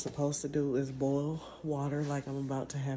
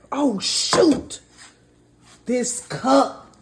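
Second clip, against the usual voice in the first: loud startled cry; sudden shocked exclamation; startled outburst; 9 semitones higher, 14 dB louder, much slower than usual. Real, not acted. Cup melts during steaming.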